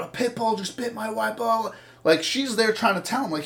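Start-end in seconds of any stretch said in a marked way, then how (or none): 0.0-1.8 higher, mocking voice of a panicked husband; mock hysterical man. The husband calling the cops, mock hysterical and higher. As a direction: mimic his panic, a touch hammed-up. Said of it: "he's so hysterical".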